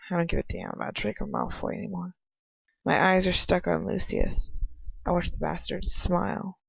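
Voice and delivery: usual speaking voice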